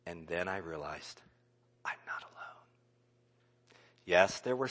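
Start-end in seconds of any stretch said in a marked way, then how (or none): 1.8-2.7 a soft secret realization; whispered revelation; hushed whisper. Real, not acted. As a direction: breathe it in awe.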